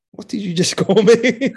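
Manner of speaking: high, loud and breaking into laughter; mock outrage that collapses into laughing; laughing disbelief. Retold shock at newspaper label, laughing.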